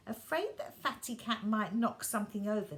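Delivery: plain reading voice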